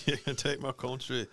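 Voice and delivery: put-on half-remembered Braveheart line in Scottish accent; Mel Gibson. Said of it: "can you do a Scottish accent?".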